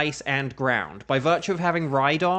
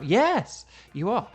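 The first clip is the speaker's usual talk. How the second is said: playful yes; 6 semitones higher, slower than usual. Pretend. Teasing reply.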